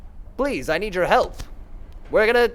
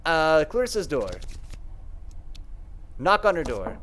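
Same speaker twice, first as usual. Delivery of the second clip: ordinary delivery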